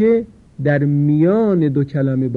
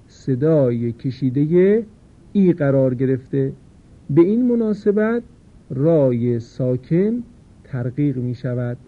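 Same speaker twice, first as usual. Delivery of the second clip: ordinary talk, nothing marked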